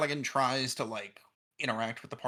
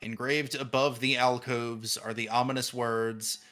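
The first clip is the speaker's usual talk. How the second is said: game master narration; 2 semitones lower, 5 dB louder, slower than usual. Acted. GM scene setting.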